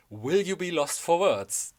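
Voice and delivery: slow, looming prophecy voice; ominous motto. Acted. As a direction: read it as a dark movie-trailer line. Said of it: "a more ominous motto".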